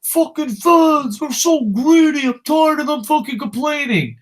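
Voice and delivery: high, drawn-out loud caricature of complainers; high-pitched sneering fan impression; mocking whiny fans. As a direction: put on a whining, high mock voice. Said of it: "horrible fucking human beings like".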